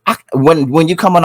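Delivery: ordinary talk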